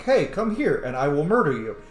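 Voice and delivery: ordinary talk, nothing marked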